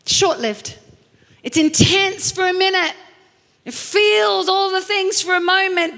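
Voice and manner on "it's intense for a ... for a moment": breathless infatuated burst; excited lift. Shown as a demonstration. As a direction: sell the rush of a crush. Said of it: "mimics fleeting intense passion".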